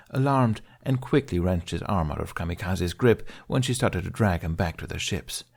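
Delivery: usual speaking voice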